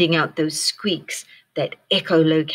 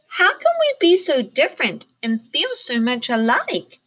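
high, wondering bird voice; musing; 8 semitones higher, 4 dB louder than usual. A narrator's reading. Flitter musing about friendship, high wondering voice. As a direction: wonder it aloud thoughtfully. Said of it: "mused Flitter".

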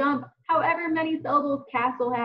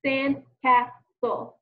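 slow "Sandcastle" in three beats, sand, cas, tle; "Sandcastle" tapped out syllable by syllable; split into syllables; much slower than usual. Shown as a demonstration. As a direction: pause after each syllable of "Sandcastle". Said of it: "Again, watch me".

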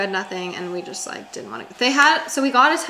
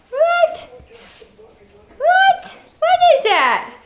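high pet-talk voice; 10 dB louder, much slower than usual. Real, not acted. To her dogs over a toy, high squeaky pet talk. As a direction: coo at the puppy in a squeaky voice.

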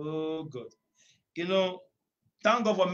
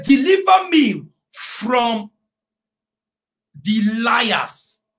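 full-force preaching; 3 semitones higher, 8 dB louder, much slower than usual. Acted. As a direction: thunder the verse over the congregation.

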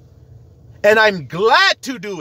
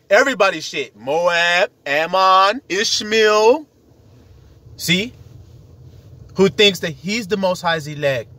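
usual speaking voice

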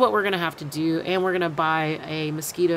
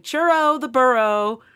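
calling out; 5 semitones higher, 5 dB louder than usual. Retold or imitated. Calling to donkey in field.

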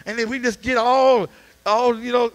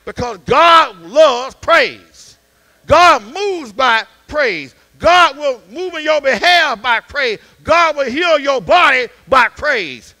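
louder, slow, each phrase hammered; slow emphatic preaching; 4 semitones higher, 10 dB louder, slower than usual. Acted. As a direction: drive every line home to the church.

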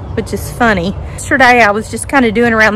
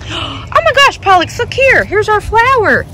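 much higher, delighted; excited pet voice; 8 semitones higher than usual. Real, not acted. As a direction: show the dog the flower with delight. Showing dog the flower.

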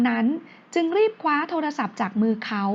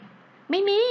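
quick denial, pitched well up; high-pitched answer; 9 semitones higher than usual. A narrator's reading. Woman denies to boyfriend in a high voice. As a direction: blurt it out high, caught off guard. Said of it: "เธอตอบเสียงสูง".

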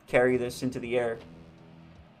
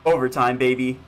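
loud celebration; 9 dB louder than usual. Real, not acted. Celebrating reaching overtime.

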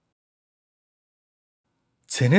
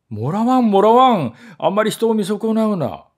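higher, loud protest; indignant shout; 8 semitones higher, 5 dB louder than usual. Acted. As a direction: yell back, deeply offended. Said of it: "とネズミ捕りは怒鳴りましたが".

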